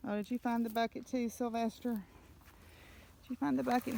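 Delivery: sing-song coaxing of the animals; talking to a dog. Real, not acted. Talking to pets with treats.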